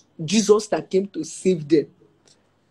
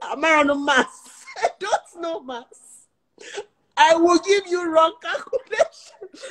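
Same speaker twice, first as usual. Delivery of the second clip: laughing; 11 semitones higher, slower than usual. Real, not acted. Laughing retelling her reply.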